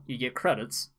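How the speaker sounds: ordinary talk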